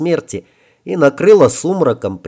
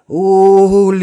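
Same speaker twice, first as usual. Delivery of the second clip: a ghost's high moaning cry; 8 semitones higher, 6 dB louder than usual. Acted. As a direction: cry out the name in pain, like a ghost. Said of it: "простонала на ментальном уровне Адель".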